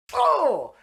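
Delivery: high, loud "oh" of delight; excited squeal. Real, not acted. Fan reacting to Boone's comeback, excited squeal. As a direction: let out a high, loud fangirl "oh". Gushing over a favourite character's reply.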